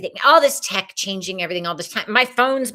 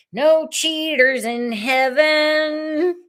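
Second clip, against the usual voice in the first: high, slow, stretched-out delivery; drawn-out mock proclamation; 7 semitones higher, 5 dB louder, much slower than usual. Host hammering home the small print, high mock proclamation. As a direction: stretch it out high and sing-song. Mock announcing repeat of phrase.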